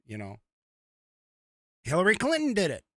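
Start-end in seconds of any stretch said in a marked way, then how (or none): 1.8-2.8 fast, higher, louder put-on twang; bad mock accent. Mocking Hillary Clinton's borrowed accent, fast and higher. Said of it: "That was a bad impression".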